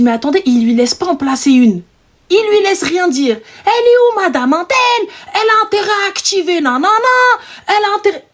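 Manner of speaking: high, insistent mock rant; irate caller. Angry client demands madame Antel on the phone, irate. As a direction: imitate an indignant caller demanding someone.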